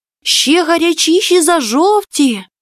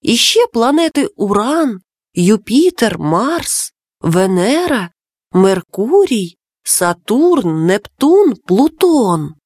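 ordinary delivery, nothing marked

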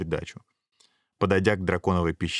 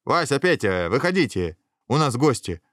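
raised-pitch call into a cave; 5 semitones higher than usual. A narrator's reading. Dragon head summoning the other heads, calling out. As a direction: call the others out to see guests. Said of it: "крикнула в пещеру".

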